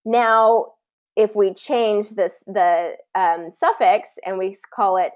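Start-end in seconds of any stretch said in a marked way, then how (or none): none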